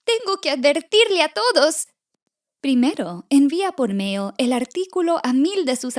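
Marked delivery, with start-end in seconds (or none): 0.0-1.9 high, urgent little chick's cry; frightened chick calling out; alarmed cry. Acted. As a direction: raise the alarm in a pitched-up voice. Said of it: "grita Pollito Tito".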